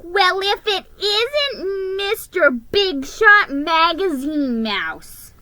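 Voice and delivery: high, slow mocking elf voice; sarcastic elf taunting the mouse; snorting sneer. A narrator's reading. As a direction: drag it out with scorn. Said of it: "he snorted".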